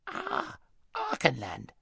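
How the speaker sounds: put-on high, wheezy old hedgehog; a slow country hedgehog, mildly surprised. A narrator's reading.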